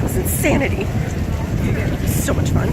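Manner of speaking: hoarse breathy whisper; raspy whisper. Real, not acted. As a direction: voice gone from screaming. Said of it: "I have no voice".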